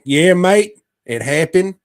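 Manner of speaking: put-on high, loud, rushed "mate" accent; mock doctor, Cockney-style accent. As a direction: crow it in a broad comic Cockney-style accent. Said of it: "And the doctor's like".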